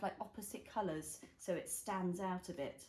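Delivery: ordinary delivery, nothing marked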